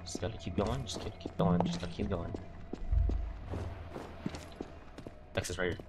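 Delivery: tense hushed self-reassurance; scared horror game streamer. Real, not acted. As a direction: quietly talk yourself through fear. Sneaking, calming self.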